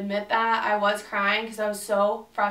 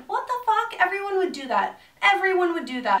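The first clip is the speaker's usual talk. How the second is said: much higher, louder cry of outrage; higher and louder, appalled; outraged disbelief; 9 semitones higher, 5 dB louder than usual. Real, not acted. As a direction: shriek out how absurd it is.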